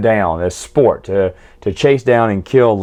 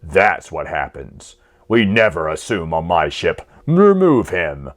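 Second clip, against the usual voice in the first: slow, lower, menacing admiral voice; cold villain admiral; 6 semitones lower, slower than usual. Retold or imitated. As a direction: play the admiral who just shot his officer, cold and unhurried. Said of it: "He goes".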